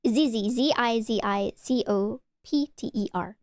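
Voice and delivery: ordinary talk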